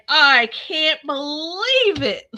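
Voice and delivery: high, very slow disbelief; mock amazement. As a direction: drag it out in fake wonder. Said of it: "he's like".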